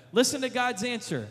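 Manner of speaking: ordinary talk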